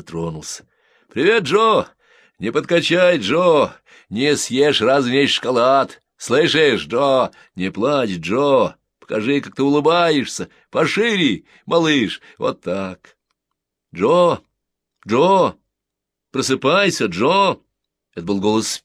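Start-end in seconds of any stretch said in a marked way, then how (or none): 1.1-13.2 loud, high cheerful calling; shouted farewell. A narrator's reading. Soldiers shout cheerful goodbyes to the boy. 13.9-17.6 loud, high calls; calling to wake. A narrator's reading. Older boy loudly wakes Joe.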